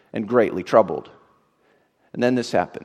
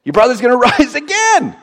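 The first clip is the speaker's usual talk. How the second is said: laughing Jesus; 13 semitones higher, 9 dB louder than usual. Acted. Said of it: "And so Jesus says".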